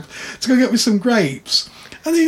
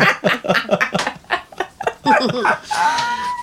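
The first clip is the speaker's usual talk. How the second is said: high breathy laugh; 3 dB quieter than usual. Real, not acted. Melon joke.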